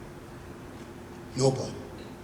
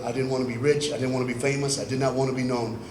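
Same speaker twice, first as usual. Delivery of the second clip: ordinary talk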